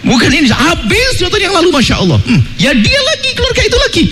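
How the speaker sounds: put-on high child voice gossiping with a laugh; excited child. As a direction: squeal like a kid egging dad on. Child joins in the grudge.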